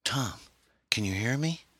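a hint of a worried wife calling to a waking husband; distant pleading voice. A narrator's reading. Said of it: "The voice seemed far away".

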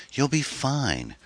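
coaxing. A narrator's reading. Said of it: "Karen coaxed".

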